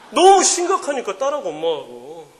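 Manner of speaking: ordinary talk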